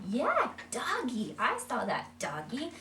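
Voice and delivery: high, slow, delighted "Yeah, doggy"; excited praise. Shown as a demonstration. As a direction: reward the child with joy. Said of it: "We just wanna".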